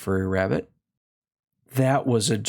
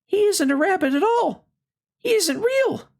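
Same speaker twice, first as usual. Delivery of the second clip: very high, fast, slightly louder accusing cry; alarmed wild rabbit; 4 dB louder than usual. A narrator's reading. Wild rabbit denounces the Velveteen Rabbit, high and alarmed. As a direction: back away and declare it loudly.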